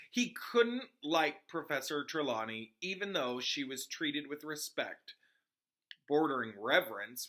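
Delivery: steady read-aloud storytelling voice; story narration. Acted. Reader narrating a book chapter to her audience, storytelling. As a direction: read the chapter to listeners, even and clear. Reads Harry Potter aloud.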